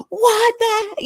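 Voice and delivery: high, loud panicked cry; retold scream. Reliving seeing the creature with Matt, panicked shriek. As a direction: shriek it like you just saw the creature. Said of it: "we both start screaming".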